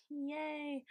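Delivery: whispered, deadpan "yay" with a laugh; sarcastic yay. Real, not acted. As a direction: fake cheer under your breath. Sarcastic about being last.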